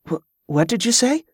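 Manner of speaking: a high, stammering question; startled. A narrator's reading. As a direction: caught off guard by the strange words. Boy startled by strange speech.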